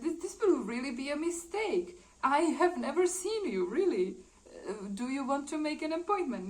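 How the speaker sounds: utterly confused. Retold or imitated. Confused man tells her he has never seen her, baffled. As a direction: act out a bewildered denial, pitch lifted.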